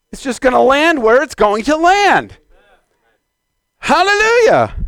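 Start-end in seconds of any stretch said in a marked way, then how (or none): 3.8-4.7 high, ringing shout of praise; exultant praise call; soaring hallelujah. Real, not acted. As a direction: lift it way up in joy. Preacher exclaims praise again.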